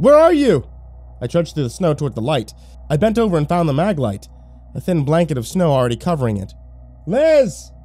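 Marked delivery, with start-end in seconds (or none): 0.0-0.6 loud, high call of a name; shouting. Acted. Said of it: "I shouted". 7.1-7.7 high, loud call of a name. Acted. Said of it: "I called again".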